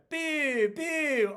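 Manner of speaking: very high, loud hammed-up old-woman boo; quoting the booing old lady. Fan quoting the old lady's boo, very high, loud and hammed up. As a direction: squeal the boo like a jeering old crone. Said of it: "we quote the".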